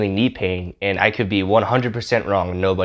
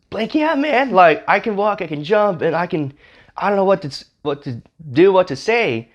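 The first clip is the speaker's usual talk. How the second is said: high, breaking off mid-phrase; retold giddy disbelief, voice pitched way up; acted overwhelmed excitement; 9 semitones higher than usual. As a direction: imitate a man too amazed to find words. Retelling healed man's overwhelmed answer.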